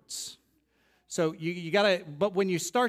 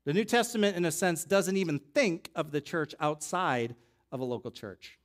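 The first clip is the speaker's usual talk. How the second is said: usual speaking voice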